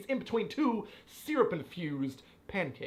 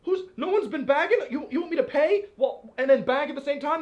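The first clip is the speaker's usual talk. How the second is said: high, loud, disbelieving rant; incredulous outrage; 10 semitones higher, 7 dB louder than usual. Real, not acted. To his partner about the Lidl checkout, high loud disbelief. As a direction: rant in real disbelief that nobody bags. Outraged at missing bag boy.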